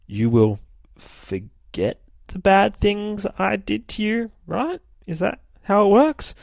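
put-on very high, slow child's voice; innocent girl voice. Viltrumite girl asking about forgiveness in a very high voice. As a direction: ask it high and sweet, naively. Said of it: "this other chick's just like".

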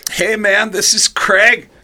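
big, high, loud mimicry. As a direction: introduce him to the band, high and loud. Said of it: "lock in that [name] impression".

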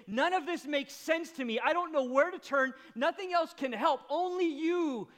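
Mary at Jesus' feet, anguished and loud; anguished cry. Acted. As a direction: cry it out in raw brokenness. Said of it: "as if to say".

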